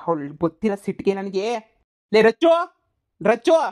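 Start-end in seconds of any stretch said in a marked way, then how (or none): 2.1-3.6 loud yell, rising on the name; angry shout for the wife; yelling her name. Acted. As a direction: bellow for her to come.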